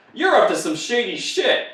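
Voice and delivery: put-on high, loud accusing girl's voice; mock high-pitched accusation. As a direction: call him out like a suspicious girl. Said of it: "this girl who's like".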